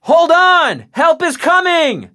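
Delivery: urgent shout across roaring water. Acted. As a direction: shout down to the boys on the rock. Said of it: "he shouted down at them".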